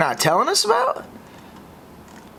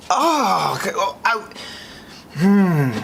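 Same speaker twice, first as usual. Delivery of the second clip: near tears; 2 semitones higher, slower than usual. Real, not acted. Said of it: "I'm gonna cry".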